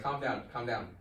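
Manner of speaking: normal talking voice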